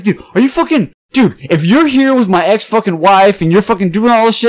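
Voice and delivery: high, loud rant of an accusing stranger; angry accusation. Retold or imitated. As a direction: imitate a worked-up man hurling accusations.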